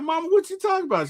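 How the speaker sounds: high, fast and bewildered, just woken up; groggy high-pitched confusion. Retold or imitated. Son just woken by mom, high and confused. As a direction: play the dazed son squeaking up in disbelief.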